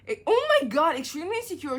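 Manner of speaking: scandalised reading; shocked outburst. Real, not acted. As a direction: blurt it out in disbelief. Shocked at review.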